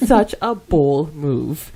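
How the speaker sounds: usual speaking voice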